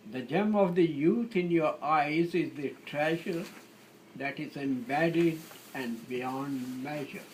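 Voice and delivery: measured verse reading with long pauses; poetry recitation. Acted. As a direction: recite your love poem line by line. Recites own sonnet.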